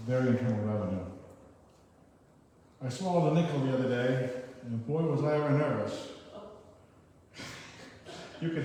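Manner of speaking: loud comic verse, half-sung on "nervous"; poem reading. Acted. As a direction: play the joke verse to the crowd.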